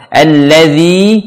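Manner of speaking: "Alladzi" slow, final I fully stretched; full kasrah. Shown as a demonstration. Teacher perfecting the vowel for learners, "Alladzi" with full kasrah. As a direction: draw out a clear long I in "Alladzi". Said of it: "dengan menyempurnakan kasroh".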